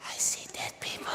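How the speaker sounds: put-on whispered I see dead people like the scared boy; eerie whisper. Shown as a demonstration. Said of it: "Remember how he said it?".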